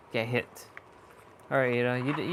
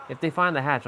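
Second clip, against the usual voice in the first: usual speaking voice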